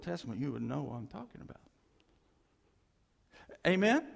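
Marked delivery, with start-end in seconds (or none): 3.7-4.1 call for amen. Real, not acted. Preacher calls for congregation response.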